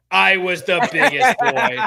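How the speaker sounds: slow, puffed-up boast; proud. Shown as a demonstration. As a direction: puff up like a proud kid.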